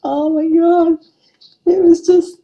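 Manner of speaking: high, loud, laughing oh my God. Real, not acted. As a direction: speak still caught in laughter. Still laughing at the story.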